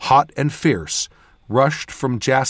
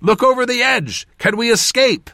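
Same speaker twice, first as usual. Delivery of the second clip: urgent high-pitched shouted order and question; shouting; 11 semitones higher, 3 dB louder than usual. A narrator's reading. Mage asks Jas for an escape route, shouting. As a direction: call out urgently, voice pitched right up. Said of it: "I shouted".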